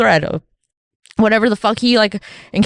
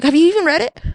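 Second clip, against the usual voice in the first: incredulous challenge; 9 semitones higher than usual. Retold or imitated. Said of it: "some people, like".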